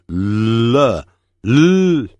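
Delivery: 了 with a long, held L at the start; L sound demo. Shown as a demonstration. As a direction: stretch the L of 了, then hold it alone. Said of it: "就是你要讲了".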